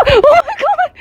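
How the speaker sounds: high, loud shriek of shock; shriek, then cry out in fright; loud high-pitched panic; startled scream. Real, not acted. Startled by the giant mouse.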